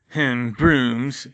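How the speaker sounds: plain reading voice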